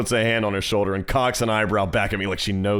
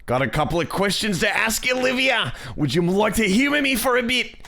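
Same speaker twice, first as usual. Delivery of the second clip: high-pitched, hammed-up Australian accent; [name]; 9 semitones higher than usual. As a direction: lay on a bad, high Aussie accent. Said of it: "the shitty Australian accent".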